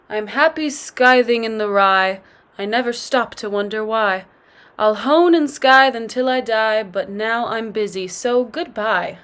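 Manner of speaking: usual speaking voice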